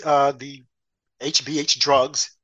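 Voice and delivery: ordinary talk, nothing marked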